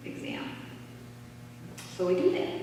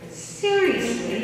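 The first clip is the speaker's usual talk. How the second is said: slow, higher and louder "Seriously?"; disbelief; 10 semitones higher, 5 dB louder, much slower than usual. Retold or imitated. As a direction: stretch it out in amazed disbelief. Stunned at money's arrival.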